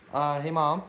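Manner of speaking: calling out to another room. Real, not acted. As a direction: raise the voice to reach mom. Calling to mom elsewhere.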